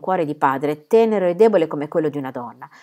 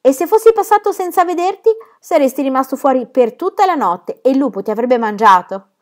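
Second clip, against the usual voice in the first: higher, louder, playful scare; teasing warning; 7 semitones higher, 6 dB louder than usual. Acted. Germain warns his son about the wolf, playful scare. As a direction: warn the child in mock fright.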